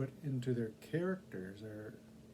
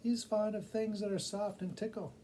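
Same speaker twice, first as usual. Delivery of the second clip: excited tiny bat bringing gossip; high squeaky bat; 9 semitones higher than usual. A narrator's reading. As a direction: play the little bat, eager with news. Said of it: "said the bat".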